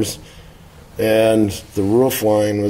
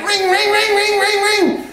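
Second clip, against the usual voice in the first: high-pitched revving engine noise, far above the usual voice; revving engine imitation. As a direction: rev it up like a tiny Suzuki engine straining at the line. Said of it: "he's revving it like".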